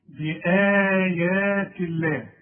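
بآيات الله slow, the ءا stretched; slow recitation of بآيات الله; long alif. Shown as a demonstration. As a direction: draw out بآيات. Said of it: "تديها ألف كاملة".

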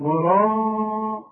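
وراء, slow, the ر heavy with rounded lips; teacher demonstrating the lip position. Shown as a demonstration. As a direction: push the lips forward as if for a kiss on وراء. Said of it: "مد شفايفك على هيئة قبلة".

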